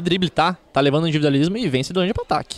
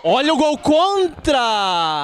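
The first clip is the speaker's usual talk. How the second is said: sudden shout; 9 semitones higher, much slower than usual. Real, not acted. Sudden own goal alarm.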